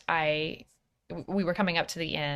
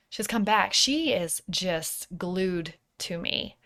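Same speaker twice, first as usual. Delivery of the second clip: usual speaking voice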